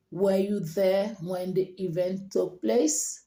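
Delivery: slow, even question lifting on "place"; rising intonation. Shown as a demonstration. Teacher models a question for learners, rising on "place". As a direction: keep it level until "place", then go up. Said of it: "So it's going up".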